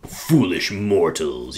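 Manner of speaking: dark lord. Pretend. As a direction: sneer it like a supervillain. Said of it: "You could, like, Doctor Doom it".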